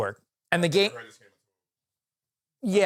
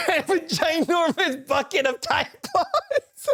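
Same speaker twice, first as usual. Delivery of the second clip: words squeezed out through laughter, pitched way up; can barely get it out for laughing; giggling hard mid-sentence; 11 semitones higher than usual. Real, not acted.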